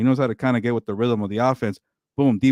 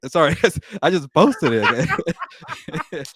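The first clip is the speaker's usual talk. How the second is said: laughing apology; 6 semitones higher, much slower than usual. Real, not acted. Laughing after worked-up rant.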